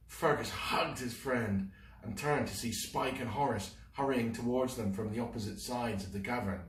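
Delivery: plain reading voice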